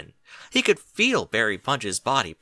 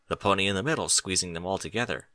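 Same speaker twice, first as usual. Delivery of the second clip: plain reading voice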